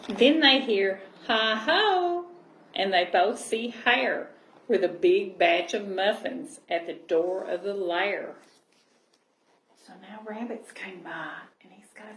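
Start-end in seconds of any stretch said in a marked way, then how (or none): 0.1-8.3 gentle storybook reading voice; cosy read-aloud storytelling; reading to children. Acted. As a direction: read it like a bedtime picture book. Reading picture book aloud.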